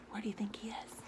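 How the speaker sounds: soft secret whisper to the listener; whispered question. Real, not acted.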